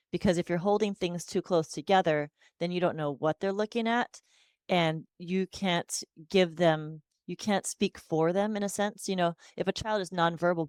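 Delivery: ordinary talk, nothing marked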